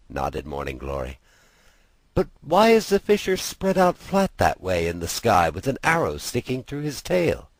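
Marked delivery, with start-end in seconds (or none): 2.1-7.5 a hint of a curious girl, higher, quick at first; lightly voiced girl. Sister asking brother about the fisher, lightly voiced curious girl. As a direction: inquisitive little sister, brisk.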